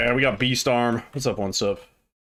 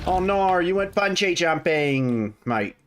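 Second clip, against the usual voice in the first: higher, slow mock Australian drawl; bad Australian accent; 6 semitones higher, slower than usual. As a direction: ham up an Aussie, badly. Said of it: "not a good Australian accent".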